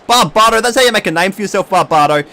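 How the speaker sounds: high, loud, fast play-by-play; hyped commentary. Real, not acted. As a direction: call it like a frenzied commentator.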